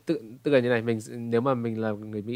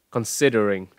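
Considering punched on SID, then falling away; stress, then falling; 6 dB louder, slower than usual. Shown as a demonstration. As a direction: hit "sid" in Considering and step down. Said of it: "Tiếng Anh là hướng đi xuống". (Considering, nhấn "sid" rồi đi xuống)

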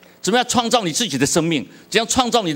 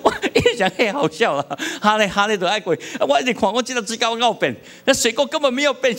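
laughing; 7 semitones higher than usual. Real, not acted.

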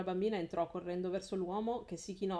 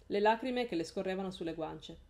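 usual speaking voice

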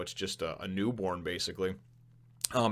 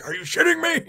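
squealing, much higher and louder; shocked disbelief; 9 dB louder than usual. Retold or imitated. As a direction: shriek it like you can't believe the climb. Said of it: "He was like".

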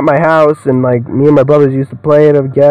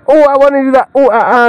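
much higher, playfully imitating a keen performer; mock eager voice; 9 semitones higher, faster than usual. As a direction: squeak it like the excited guy. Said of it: "He's like".

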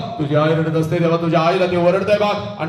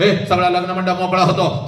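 ordinary talk

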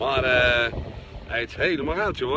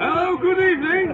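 excited greeting; 12 semitones higher, 5 dB louder than usual. Real, not acted. Same greeting at the party.

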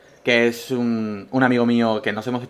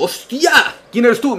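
mock surprise; 9 semitones higher than usual. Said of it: "Entré a un bar y digo".

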